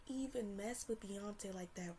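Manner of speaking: unvoiced confiding whisper; hushed admission. Real, not acted. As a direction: lean in and whisper it like a secret.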